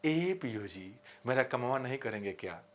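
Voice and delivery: lightly voiced village woman, low and soft; a hint of a rustic woman's plea. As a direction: softly imitate a villager pleading at the bank. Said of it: "गांव के महिलाएं जब bank में जाती हैं तो कैसे बोलती हैं?".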